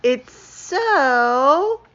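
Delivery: squeals high on "Oh" and "exciting", stretching the last word slowly; can barely contain the excitement; giddy, gushing squeal; overexcited gush. Acted. Skunk thrilled by egg dye.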